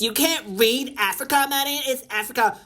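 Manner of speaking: high-pitched imagined heckler; mock commenter. Streamer imitating viewers correcting her reading, high mock chiding. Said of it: "Here goes the comments".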